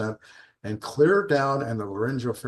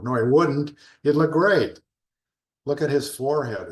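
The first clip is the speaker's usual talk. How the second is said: emphatic rebuttal; 5 semitones higher, 6 dB louder than usual. Real, not acted. Rebuts imagined objection emphatically.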